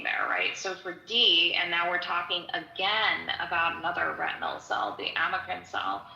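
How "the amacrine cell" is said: usual speaking voice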